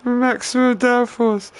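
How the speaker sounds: high, drawn-out mock proclamation; scornful, squeaky-high overstatement. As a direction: stretch it out loud and high in mockery. Mocking a YouTuber's setup advice.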